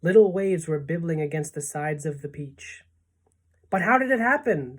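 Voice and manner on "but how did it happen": high-pitched, dismayed question; bewildered cry. A narrator's reading. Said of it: "they cried".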